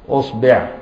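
أصبع said usbeʕ with u on the hamza and i on the b; vowel variants. Shown as a demonstration. Teacher to students, أصبع said usbeʕ. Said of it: "يعني بضم وفتح وكسر الهمزة".

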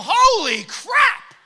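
startled shout. Retold or imitated. Startled man yelling at the masked shopper, slow loud shout. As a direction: drag it out in shock. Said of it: "I go".

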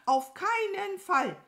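high, loud crow outburst; emphatic refusal. Acted. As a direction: refuse with shrill indignation. Crow flatly refuses to climb down. (empört und laut ablehnen)

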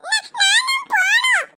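put-on whiny child plea. Child pleading to go in.

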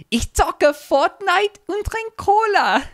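put-on very high, slow little German kid; tiny German voice. Quoting the boy playing Fortnite, tiny German voice. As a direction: draw it out in a squeaky child voice. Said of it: "in the tiny German voice".

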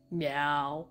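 low, soft meow rising at the end; a small questioning meow; quiet cat meow. Acted. Cat meowing softly at its owner.

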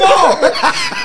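loud high burst of laughter. Real, not acted. Laughing after the smoke punchline, loud and high. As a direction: crack up at the punchline.

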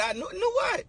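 high-pitched disbelieving question; incredulous retort. Retold or imitated. Man retelling his reply to a boast about ancestry, incredulous. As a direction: squeal it in disbelief.

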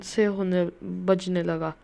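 ordinary talk, nothing marked

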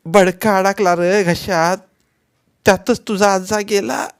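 weeping grandmother, breaking on the last lines; grandmother crying. A narrator's reading. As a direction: tell the grief through tears. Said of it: "आजी रडतच म्हणाली".

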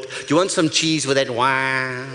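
slow, lightly mocking American whine; a hint of a whiny American; American accent. Comic imagines Yank speaking, slow mild American accent. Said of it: "Whenever I hear a Yank speak".

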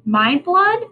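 shrieking in horror. A narrator's reading. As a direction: shriek it out, horrified. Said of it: "shrieked Henry as loud as he could".

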